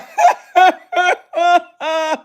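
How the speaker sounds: squealing high cackle; high laugh. Real, not acted. Laughing at the green Jolly Rancher joke, high cackle. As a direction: let a high laugh burst.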